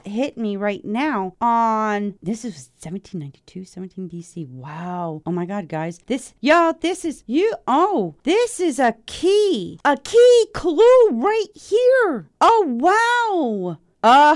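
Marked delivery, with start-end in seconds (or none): 6.1-14.4 high, loud, drawn-out excitement; thrilled squeal. Real, not acted. Host telling listeners about a clue, high excited squeal. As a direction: squeal like you just cracked the case.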